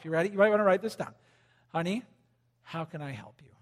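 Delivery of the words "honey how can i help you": soft, gentle question to the wife. Shown as a demonstration. Husband asking his wife softly how to help. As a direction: ask her tenderly, softer. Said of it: "I want you to say this to your wife".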